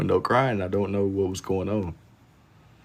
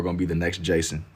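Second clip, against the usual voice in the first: ordinary talk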